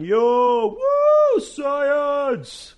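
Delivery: high, loud whooping cheer; mock hollering. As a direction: holler like a rowdy fan cheering for science. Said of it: "be on the outside hollering".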